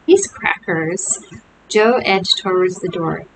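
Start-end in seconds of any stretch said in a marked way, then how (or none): none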